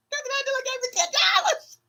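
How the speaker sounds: gleeful fast announcement; excited shout. Real, not acted. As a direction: shout the news with joy.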